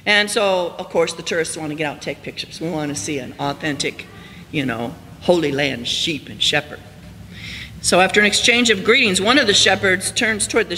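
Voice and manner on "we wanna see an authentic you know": usual speaking voice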